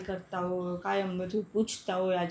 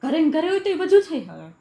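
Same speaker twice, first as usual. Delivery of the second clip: angry retort; 8 semitones higher, 6 dB louder than usual. Real, not acted. Wife accusing husband during quarrel.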